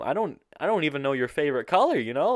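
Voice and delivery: rushed, high-volume objection; incredulous protest. Retold or imitated. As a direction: blurt it out incredulously. Re-enacts incredulous refusal.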